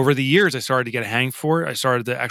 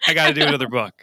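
excited rush; 6 semitones higher, 5 dB louder than usual. Pretend. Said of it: "I feel like I have to run".

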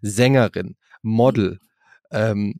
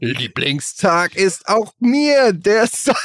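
medieval count; 6 semitones higher, much slower than usual. Pretend. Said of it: "wie so ein mittelalterlicher Graf".

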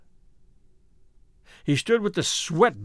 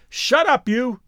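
high, sharp shout; raised, agitated yell; angry cry; 6 semitones higher than usual. A narrator's reading. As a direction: shout down the mocking voice. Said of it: "he cried".

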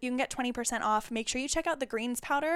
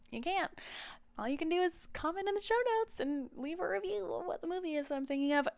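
higher, teasing sing-song; playful higher voice, teasing the listeners; 7 semitones higher than usual. Real, not acted.

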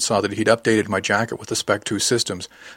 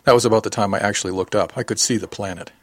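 plain reading voice

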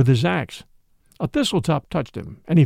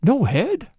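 higher, rising question of disbelief; startled repeat; 5 semitones higher than usual. A narrator's reading. Priest repeating the news in startled concern. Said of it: "he repeated".

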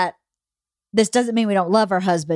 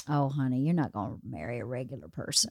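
much lower and much softer, a soothing father; dad's gentle voice; 10 semitones lower, 11 dB quieter than usual. Retold or imitated. Dad comforting his crying daughter, low and soft. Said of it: "And my dad goes".

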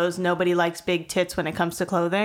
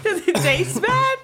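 laughing; 10 semitones higher than usual. Real, not acted. Laughing at friend choking.

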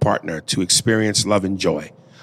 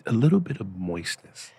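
much softer, near-whispered aside; 3 semitones lower, 11 dB quieter than usual. Real, not acted. Host confers with co-host about the caller, hushed whisper. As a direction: lean in and whisper to your co-host.

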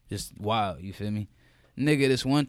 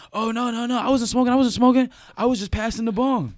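higher, louder nervous mock denial; lying teen; 8 semitones higher, 4 dB louder than usual. High teen lying to his mom, higher and pleading. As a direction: play the high kid insisting he's innocent. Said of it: "I was like".

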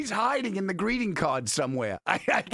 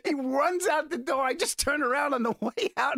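ordinary talk

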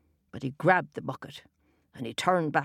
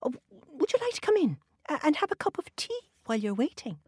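high, fast nervous invitation; Una, hurried hospitality; 10 semitones higher, faster than usual. A narrator's reading. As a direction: rush the offer to keep him calm.

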